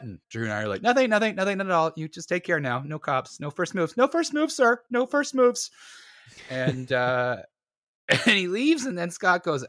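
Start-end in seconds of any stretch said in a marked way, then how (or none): 0.8-5.7 high, loud panicky babble; hammed-up squeaky panic; frantic placating. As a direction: act out desperate appeasement, climbing into a squeal. Frantically placating the mugger, retold.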